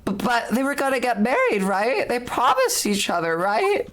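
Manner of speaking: put-on young girl, high and worried. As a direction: voice a high-pitched anxious girl. Player voices young girl character.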